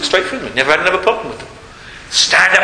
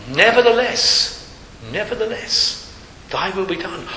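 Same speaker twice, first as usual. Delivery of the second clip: fading into quiet; 6 dB quieter than usual. Acted. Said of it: "prayer of acceptance quoted".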